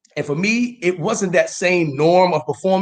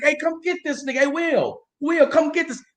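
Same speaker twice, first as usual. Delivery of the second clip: high, hollered call for Will; catchphrase call-out; 9 semitones higher, 4 dB quieter than usual. Retold or imitated. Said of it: "And I read the comments".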